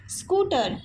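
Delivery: स्कूटर with a long oo; long vowel. Shown as a demonstration. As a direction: hold the oo in स्कूटर. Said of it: "ये हो गया long sound".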